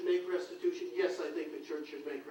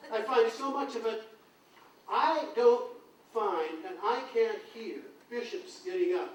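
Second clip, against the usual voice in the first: usual speaking voice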